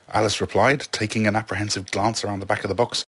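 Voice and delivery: ordinary delivery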